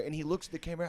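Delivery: ordinary talk, nothing marked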